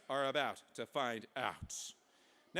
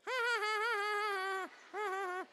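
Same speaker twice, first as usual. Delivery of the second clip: put-on very high baby giggle; baby laugh; slower than usual. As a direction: squeal out a rapid baby "ha-ha". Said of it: "Baby Pomp looked at the pill and was like".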